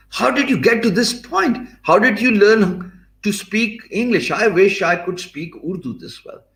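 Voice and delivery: high-pitched astonished questioning; amazed. Retold or imitated. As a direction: imitate a client who cannot believe it. Acts out amazed client questioning him.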